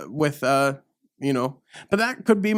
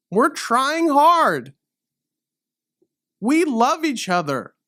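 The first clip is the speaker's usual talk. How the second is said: pleading; 6 semitones higher, slower than usual. Acted. Pleading in the rant.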